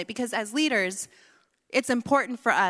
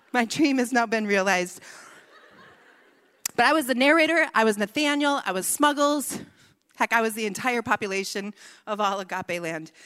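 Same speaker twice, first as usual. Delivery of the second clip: usual speaking voice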